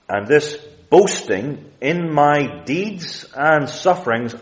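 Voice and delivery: normal talking voice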